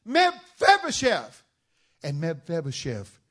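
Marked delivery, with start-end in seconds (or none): 0.0-1.4 loud, high, slow call of the name; drawn-out, ringing royal greeting; king calling out. Acted. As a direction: call across the palace to him. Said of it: "And he says to him".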